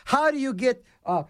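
ordinary delivery

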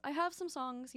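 ordinary talk

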